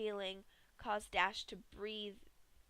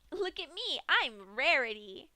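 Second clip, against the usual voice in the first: Pinky hams up Rarity; mock Rarity voice; 5 semitones higher, 7 dB louder than usual. A narrator's reading. Pinky mocks Rarity while gutting Dash, hammed-up. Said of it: "Pinky laughed".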